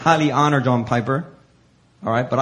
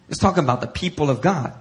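normal talking voice

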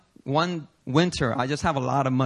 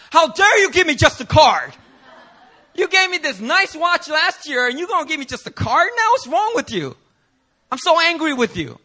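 much higher, louder hammed-up indignant whine; mock outraged friend; 12 semitones higher, 5 dB louder than usual. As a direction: play the offended friend, over the top. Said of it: "Danny comes up to me and says".

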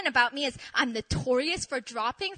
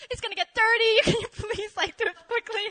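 higher, pleading and urgent; 8 semitones higher, 3 dB louder than usual. Retold or imitated. Begging staff to rescue the scarf, high pleading. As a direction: beg them to hurry.

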